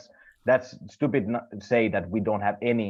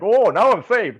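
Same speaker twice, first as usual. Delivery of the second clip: higher and louder, smug mock relief; 9 semitones higher, 8 dB louder than usual. People in cheap masks feeling safe, mock smug relief. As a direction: play a smug fool who thinks he's safe. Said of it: "you're like".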